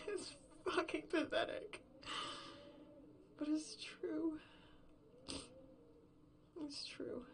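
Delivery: high, tearful words through sobs; weeping with self-disgust; crying. Acted. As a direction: say it while crying, voice cracking. Breaks down over lost partner.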